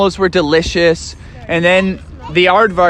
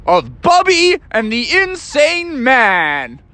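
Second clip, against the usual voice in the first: dramatic title; 7 semitones higher, slower than usual. A narrator's reading. Dramatic story title announcement.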